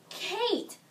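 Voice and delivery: high, worried cry of a name; acted mother calling out in sudden worry; alarmed call. Mother calls to her sick daughter, alarmed.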